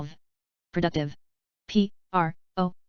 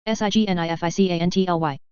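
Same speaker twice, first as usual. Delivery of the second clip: quick letter run; brisk recap spelling; fast spelling; faster than usual. Shown as a demonstration. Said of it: "spelling drill, slow then fast".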